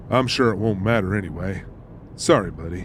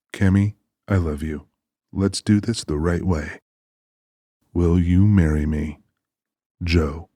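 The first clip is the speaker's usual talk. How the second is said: tender poem reading. Acted. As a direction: read it to her like a promise. Joe reads his poem.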